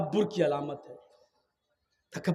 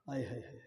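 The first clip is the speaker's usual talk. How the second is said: dismayed groan; 8 semitones lower, 15 dB quieter, much faster than usual. Pretend.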